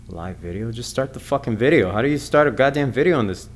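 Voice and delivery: ordinary delivery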